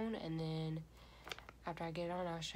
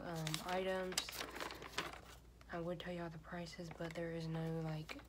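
mask over lips; speaking with face covered; talking through sheet mask. Real, not acted. Seen 2: Red cherry sheet mask covers face and lips throughout; R frames bare.

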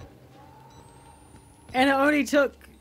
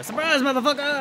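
mock surprise yell; 6 semitones higher than usual. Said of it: "Just jump over and go".

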